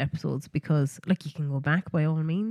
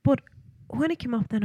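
usual speaking voice

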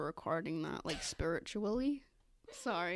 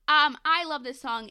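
louder and higher declaration; enthusiastic; 5 semitones higher, 12 dB louder than usual. Real, not acted. Telling her friend how much she loves the song. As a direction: declare it with gusto.

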